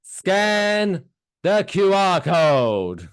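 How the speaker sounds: retelling the customer's loud slow repeat; loud slow mimicry. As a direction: mimic the man over-enunciating loudly. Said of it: "he said it louder than me".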